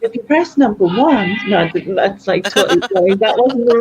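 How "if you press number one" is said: slow, mock-posh automated menu; lightly posh customer-service delivery; posh phone voice. Shown as a demonstration. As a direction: recite phone options in a put-on posh voice. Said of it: "Do your posh voice".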